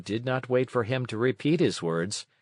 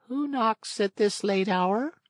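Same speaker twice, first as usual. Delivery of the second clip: much higher, slow snail voice; a tiny creature calling from a window; 11 semitones higher than usual. A narrator's reading. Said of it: "she called".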